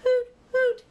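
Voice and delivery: high, loud owl hoot; hoot call. A narrator's reading. Owlet calling hoot as she flies home. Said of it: "she cried".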